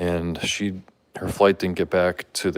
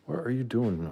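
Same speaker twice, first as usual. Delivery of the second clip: usual speaking voice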